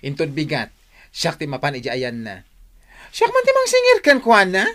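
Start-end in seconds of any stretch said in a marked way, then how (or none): 3.1-4.6 loud, high-pitched defiant declaration; defiant boast. Acted. Cousin vowing to collect the debt herself, defiant loud boast. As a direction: announce it like a challenge.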